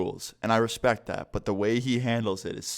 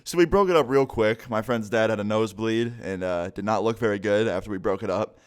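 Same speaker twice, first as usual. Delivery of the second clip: normal talking voice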